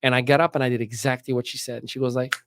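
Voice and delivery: usual speaking voice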